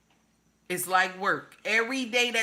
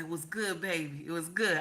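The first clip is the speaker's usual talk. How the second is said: much softer, low and intimate; husband's reply; 8 semitones lower, 9 dB quieter than usual. Retold or imitated. Husband answering his wife in bed talk, soft and low. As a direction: murmur it like a satisfied husband. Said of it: "He said".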